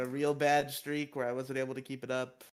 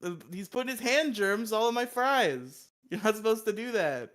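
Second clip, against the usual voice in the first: mock outrage; 8 semitones higher, 6 dB louder than usual. Continued mock outrage rant.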